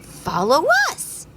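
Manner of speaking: high, light firefly voices; fireflies. Acted. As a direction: lead the way brightly. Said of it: "said the fireflies".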